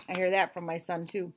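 plain reading voice

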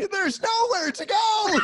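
high, drawn-out imitated wail; mock breakdown shout. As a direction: yell it like a man having a meltdown. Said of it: "video of you having a breakdown".

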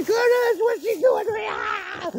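mock terror fading into the distance; fading screams. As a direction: scream as if being dragged away. Screams fading as she runs off.

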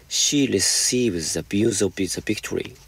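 slow, careful read-aloud of the English sentence; slow example reading. Shown as a demonstration. Teacher reading the example to students, slow and deliberate. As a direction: spell it out slowly for the class.

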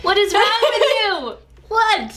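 high, shocked "what is wrong"; surprised. Real, not acted. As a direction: react to the sour taste, high and stunned. Reacting to very sour candy.

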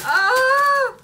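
high, loud excited squeal; delighted squeal. Real, not acted. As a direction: let out a thrilled squeal of joy. Squeal at merch.

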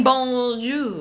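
BONJOUR drawn out with a set tune; exact bonjour intonation. Shown as a demonstration. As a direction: stretch out BONJOUR in a showy singsong. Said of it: "with that exact intonation".

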